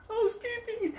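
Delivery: mock senora announcing herself in falsetto; housekeeper calling out. Senora at the hotel room door, singsong housekeeper call. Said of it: "this lady was".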